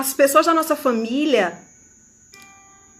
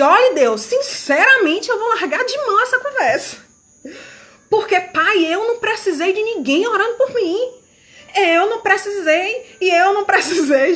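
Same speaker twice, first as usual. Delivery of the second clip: higher-pitched, insistent complaint to God; desabafo de saco cheio; 6 semitones higher than usual. Retold or imitated. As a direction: protest like someone fed up, pitch lifted. Desabafo encenado, irritada com familiar. (reclamação em tom mais agudo, de saco cheio)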